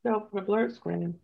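talking while chewing ice; words around crunching ice. Real, not acted. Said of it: "I'm crunching ice again".

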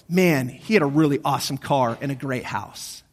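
lightly acted low tribute; mock eulogy. Imagined mourner speaking at his funeral, low mock eulogy.

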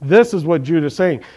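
usual speaking voice

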